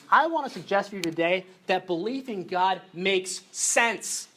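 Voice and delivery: ordinary delivery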